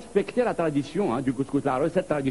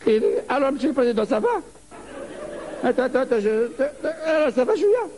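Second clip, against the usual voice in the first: higher, chuckling voice talking down; a higher, laughing, condescending voice toward the little president; patronizing the president; 6 semitones higher than usual. Acted. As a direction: pat him on the head with your voice.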